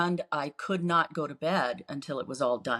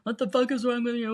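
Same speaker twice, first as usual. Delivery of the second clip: a higher, mock angry curse; retold angry cursing; cussing husband; 6 semitones higher, faster than usual. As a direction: imitate him swearing at her. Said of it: "constant cussing".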